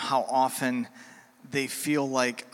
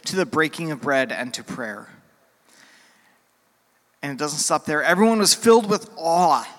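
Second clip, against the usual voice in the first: louder, higher, preaching the passage with mounting fervor; forceful scripture reading; 3 semitones higher, 6 dB louder than usual. Acted. Pastor reading Acts to the congregation, building fervor. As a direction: proclaim the Acts verses to the whole room.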